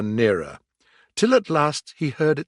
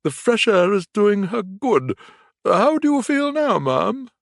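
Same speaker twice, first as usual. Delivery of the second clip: kind gentleman; 9 semitones higher, 5 dB louder than usual. A narrator's reading. Gentleman asks kindly.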